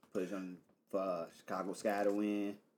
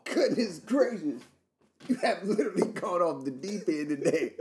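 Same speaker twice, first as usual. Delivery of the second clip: higher, louder, laughing exasperation; amused disbelief; 9 semitones higher, 8 dB louder than usual. Real, not acted. Reacting to cohost's laughing fit.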